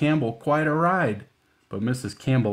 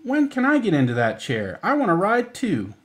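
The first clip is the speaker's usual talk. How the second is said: eager; 9 semitones higher, 4 dB louder than usual. Acted. Melissa eager to ride.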